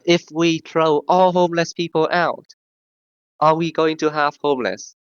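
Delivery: usual speaking voice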